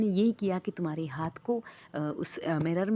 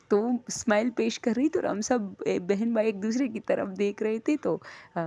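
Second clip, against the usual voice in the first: ordinary talk, nothing marked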